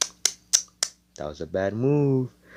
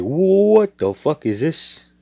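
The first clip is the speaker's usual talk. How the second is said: higher, slow, loud "What the fuck is this?"; Austin's louder redo of the line; louder outraged take; 8 semitones higher, 5 dB louder, slower than usual. Acted. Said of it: "Or Austin's like".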